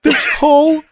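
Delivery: high, drawn-out pleading with halting pauses; Diane Keaton impression. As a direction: plead dramatically in a high, slow voice. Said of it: "Diane Keaton's performance in that is so specific".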